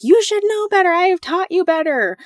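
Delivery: hammed-up exasperated parent; imitating a frustrated mom lecturing; scolding parent. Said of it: "That's what I think sometimes".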